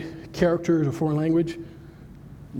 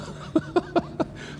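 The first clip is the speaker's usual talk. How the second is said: real amused laugh; 13 semitones higher, 6 dB quieter than usual. Real, not acted. Speaker tickled by clients knocking on doors, laughing. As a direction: laugh at your own anecdote. Laughs at his own story.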